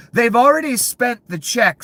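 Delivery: They've already spent the check yelled high and loud, already punched; furious shout. Real, not acted. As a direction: peak of the rant, hammer already. Ranting about greedy officials.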